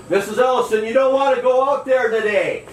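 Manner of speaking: put-on calling out to the old woman over the wind. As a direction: call to her over the wind. Calling out window to her.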